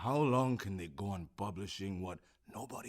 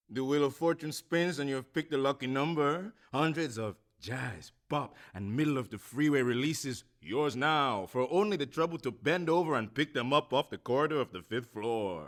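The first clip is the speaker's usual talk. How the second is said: fast-talking sideshow huckster selling records; a hammed-up barker's spiel, swelling to a big finish; carnival barker pitch; 4 semitones higher, 6 dB louder than usual. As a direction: hawk it like a fairground barker.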